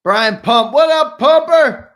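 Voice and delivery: loud, high, drawn-out greeting; booming host's welcome; hyped shout-out. Real, not acted. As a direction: holler hello to a regular. Shouts out a viewer by name.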